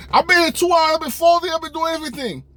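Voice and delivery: high mock-outraged teen; high indignant insisting. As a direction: dig in like a wronged kid. Pleading his two hours.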